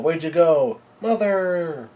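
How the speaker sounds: high, drawn-out wail for a mother; Big Mom yelling. A narrator's reading. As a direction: cry out, stretching the last word. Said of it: "Big Mom yells out".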